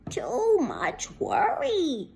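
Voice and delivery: slow, drawn-out grandma tut; slow grandmother. Acted. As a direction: sigh it like a fussy grandma. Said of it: "said her grandmother".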